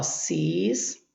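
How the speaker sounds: "sis" slowly set apart, held low; isolated first syllable. Shown as a demonstration. Teacher separating a word for students, "sis" slowly isolated. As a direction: draw out "sis" as a syllable by itself. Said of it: "vamos separar a sílaba aqui".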